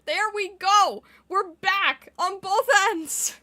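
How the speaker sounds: loud, excited and relieved; triumphant cheer. Real, not acted. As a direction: cheer that it's fixed. Stream restored, cheering.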